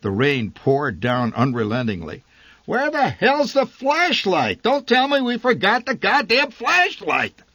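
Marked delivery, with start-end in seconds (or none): 2.6-7.4 high, loud exasperated yelling; angry father. A narrator's reading. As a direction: bark it out, fed up and soaked. Father furious in the rain.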